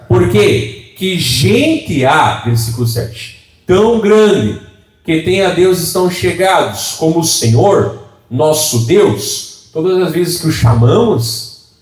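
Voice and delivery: slow, lifted reading of the verse; scripture reading. Acted. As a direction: read the verse out like a preacher, drawn out.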